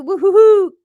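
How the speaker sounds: high, loud whooping cheer. Real, not acted. Host whooping loudly for a viewer's news. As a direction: whoop in delight.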